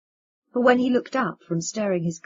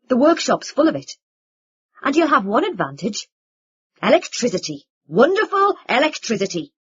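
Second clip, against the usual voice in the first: louder, higher mocking voice; bitter sarcasm; 4 semitones higher, 9 dB louder than usual. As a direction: lean hard on the irony. Vin bitter mockery.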